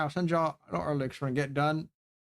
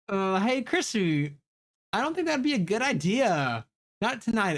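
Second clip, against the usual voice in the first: higher, hesitant warning; nervous character voice; 6 semitones higher than usual. Acted. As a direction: voice it higher and unsure. Reading a hesitant character line.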